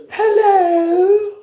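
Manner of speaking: very high, drawn-out mock telephone greeting; put-on phone voice. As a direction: exaggerate a fake, sing-song phone hello. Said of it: "You know, that phone voice".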